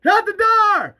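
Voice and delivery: high, urgent shouted order; shouting back. Acted. Bert calling back from the building, shouting. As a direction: yell it back over your shoulder. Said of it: "shouting back".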